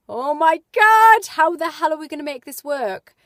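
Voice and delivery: overwhelmed shriek of worry; panicked outburst. Retold or imitated. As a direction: wail it like the money worries just hit. Panicking self-talk about the house.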